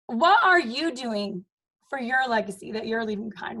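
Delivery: normal talking voice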